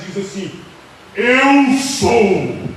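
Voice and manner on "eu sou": loud, firm declaration at normal pitch; Jesus. Acted. Jesus answers the men seeking him, with authority. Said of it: "Jesus, com a voz de autoridade".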